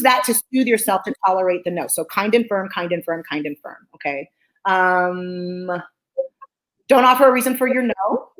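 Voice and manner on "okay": ordinary talk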